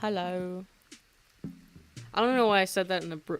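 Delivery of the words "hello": brief, casual British-accented greeting; hint of a British accent. Real, not acted. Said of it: "in a British accent".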